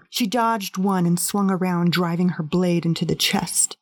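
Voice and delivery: usual speaking voice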